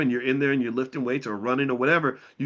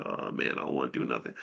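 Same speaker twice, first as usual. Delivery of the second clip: low unvoiced lazy whisper; groggy whisper; 11 semitones lower, 6 dB quieter, faster than usual. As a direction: whisper it like you can't get out of bed. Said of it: "you're like".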